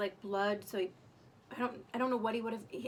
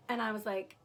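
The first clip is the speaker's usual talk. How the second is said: ordinary delivery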